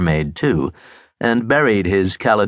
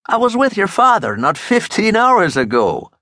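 excited; 10 semitones higher than usual. Acted. Burke excited about coincidence.

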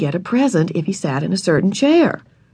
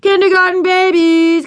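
much higher and louder, a mocking boy's chant; taunting yell; 12 semitones higher, 5 dB louder than usual. A narrator's reading. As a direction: jeer at the little ones. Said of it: "big first grade boys ran past yelling".

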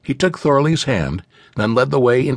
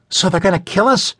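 frightened gasp; 9 semitones higher than usual. A narrator's reading. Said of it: "gasped a little blonde girl".

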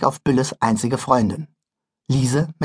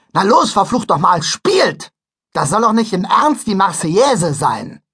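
angry scolding; 5 semitones higher, 3 dB louder than usual. Acted. Conductor scolds the band.

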